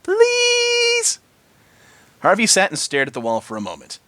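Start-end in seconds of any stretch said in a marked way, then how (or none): 0.0-1.2 very high squeaky plea; one tiny, high begging word; pleading Pinky. A narrator's reading.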